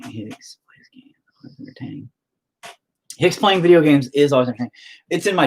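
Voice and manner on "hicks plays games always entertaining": barely voiced whisper; reading under breath. Real, not acted. Streamer reading a viewer's comment to himself, under breath.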